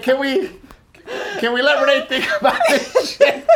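high, laughing imitation of her mother; laughing mock mother. Retelling what her mother said, high and laughing. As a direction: squeak it up while laughing. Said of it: "And she's like".

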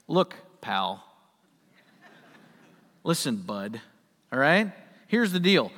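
low, lightly stern look pal; a hint of a firm scolding; mild rebuke. Master rebuking a grumbling worker, lightly stern. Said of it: "an air of rebuke".

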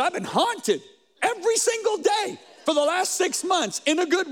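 mock-excited shout of a changed man; excited retelling. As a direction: burst out with thrilled conviction. Friend's excited report retold.

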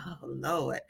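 low, soft sigh of dread; resigned aside. Retold or imitated. Speaker recalls feeling the squeeze return, resigned and low. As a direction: drop it low and quiet, almost to yourself.

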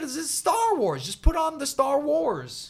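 put-on high, loud, slow childish insistence; stubborn kid. A seven-year-old demanding his brother put on Star Wars.

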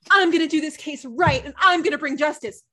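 hammed-up dramatic Peter; mocking Peter. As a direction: puff up like a self-important lawyer. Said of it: "He's like".

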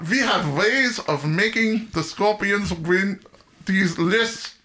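menacing movie-villain German accent, played for laughs; drawn-out jokey German accent; mock German accent. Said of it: "you better do a German accent".

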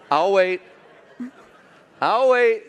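laughing through it, high and loud; laughing I'll wait. Real, not acted. Preacher waits for the congregation to get the joke, laughing. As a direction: wait out the crowd, cracking up. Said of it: "waiting for the joke to land".

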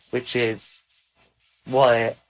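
ordinary talk

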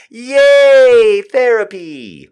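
high loud mock cheer. Host cheering herself on, mock celebratory whoop. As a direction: cheer it like a sarcastic party horn.

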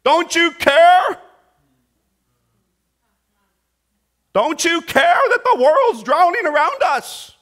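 high, loud, frantic cry; panicked plea. Acted. Disciples crying out to sleeping Jesus, panicked and high. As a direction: beg like the boat is going under.

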